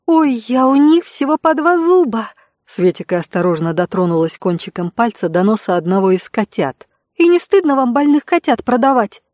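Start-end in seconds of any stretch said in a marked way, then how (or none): 0.1-2.3 put-on high, delighted young girl; excited girl's voice. A narrator's reading. 7.2-9.2 put-on high, reproachful young girl; indignant girl's voice. A narrator's reading.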